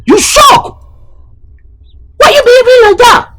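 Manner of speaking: high-pitched exasperated reproach; indignant scolding. Acted. Uncle scolding Adam for misbehaving, indignant. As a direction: scold with the pitch pushed right up.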